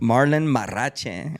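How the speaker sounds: Marrache said slowly with a rolled Spanish R. Shown as a demonstration. Host rolling the R in his guest's surname. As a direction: roll the R in Marrache, Spanish style. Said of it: "I had to roll that R".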